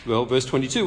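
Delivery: usual speaking voice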